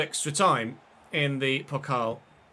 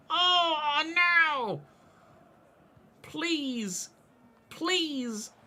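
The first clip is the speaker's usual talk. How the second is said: anguished near-miss cry; 13 semitones higher, much slower than usual. Real, not acted. Chance goes begging in game.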